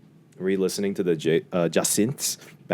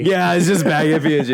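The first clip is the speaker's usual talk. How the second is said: high, loud giddy echo; high laughing voice; 10 semitones higher, 6 dB louder than usual. Retold or imitated. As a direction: repeat it squeaky with glee. Echoing joke in high voice.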